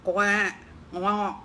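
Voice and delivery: mock oinking pig grunts. Sleepy pig grunting at the mouse, a mild mock oink. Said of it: "nggak bisa ngikutin suara babinya".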